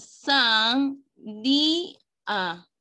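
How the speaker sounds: "san, di, a" in three drawn-out, separate pieces; syllable by syllable. Shown as a demonstration. Teacher splitting sandía for pupils, "san, di, a" syllable by syllable. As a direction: break "san, di, a" apart slowly, lifting "di".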